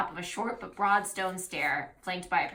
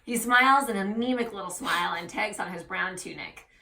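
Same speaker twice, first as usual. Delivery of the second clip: ordinary talk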